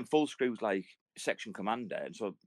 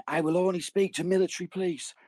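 higher, louder pompous soldier voice; stubborn veteran; 8 semitones higher, 4 dB louder than usual. Retold or imitated. Telling mates about an arrested veteran, pompous soldier voice. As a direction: play the drunk veteran demanding his rights. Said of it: "he starts going".

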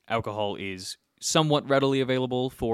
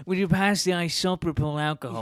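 higher-pitched put-on British voice; mock British accent; 5 semitones higher than usual. Mimicking British people who say it oddly, mock British accent. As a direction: imitate a polite British request, pitched up.

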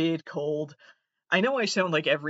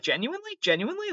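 rising disbelief, climbing higher; genuinely? genuinely? rising higher in disbelief; incredulous; 12 semitones higher, faster than usual. Acted. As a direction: ask it again, unable to believe it. Incredulous repeat.

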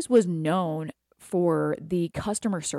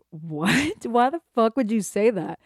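louder, higher affronted retort; pissed disbelief; 5 semitones higher, 4 dB louder than usual. Retold or imitated. Speaker to ex who called the client following a cult, pissed disbelief. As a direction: retell an incredulous, offended comeback. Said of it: "I was kinda pissed".

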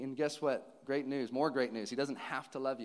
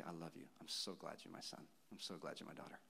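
very soft, low murmur; hushed, as if tucking a child in; tender bedtime reassurance; 9 semitones lower, 15 dB quieter than usual. Acted.

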